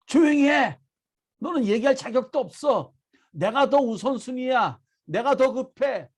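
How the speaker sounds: preacher imitating an angry scolding; scolding crowd. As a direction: act out telling a beggar off, increasingly forceful. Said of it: "꾸짖는 거예요".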